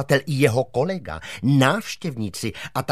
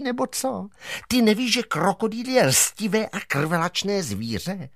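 put-on much higher, reproachful girl's voice; angry girl; 9 semitones higher than usual. Schoolgirl berates Mach after the dog is swallowed, high and reproachful. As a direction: berate him in a high squeaky scold.

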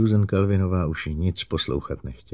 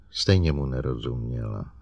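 plain reading voice

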